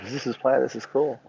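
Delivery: soft retold murmur; understated quiet wonder; hushed realization. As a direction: repeat it quietly, as a thought to yourself.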